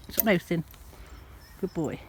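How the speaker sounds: ordinary talk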